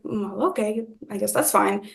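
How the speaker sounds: soft, hesitant acceptance; reluctant agreement. Retold or imitated. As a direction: give in quietly, unsure. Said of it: "I wasn't really comfortable".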